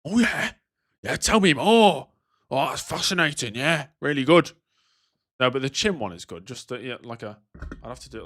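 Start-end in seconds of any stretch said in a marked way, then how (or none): 0.0-4.5 louder, drawn-out fake interest; mock interested listener. Streamer mocking a listener who touches his hair, fake-interested. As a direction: ham up an attentive listener. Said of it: "That'd be kinda weird, just".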